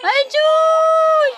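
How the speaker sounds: loud, sky-high cry of mock despair; exasperated exclamation. Real, not acted. Mother exclaiming over her screaming baby, mock despair. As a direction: throw it up high like you give up.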